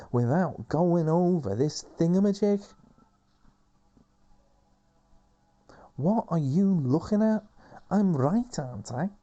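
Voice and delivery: put-on high, loud, bossy young woman; high bossy girl voice. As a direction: play her pushy and sure of herself. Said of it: "Mary says".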